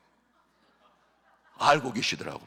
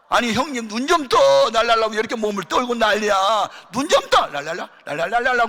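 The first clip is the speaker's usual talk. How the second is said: higher, louder alarmed calls; panicked shouting; 6 semitones higher, 7 dB louder than usual. Retold or imitated. Uncles shouting at their collapsed brother, panicked. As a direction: shout at him to open his eyes.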